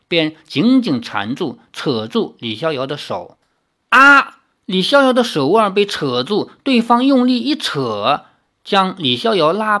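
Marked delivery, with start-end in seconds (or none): 3.9-4.4 a loud, high, sudden ah; startled cry. Acted. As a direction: cry out as the vine grabs your wrist. Hero caught by the vine.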